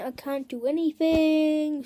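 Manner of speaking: ordinary delivery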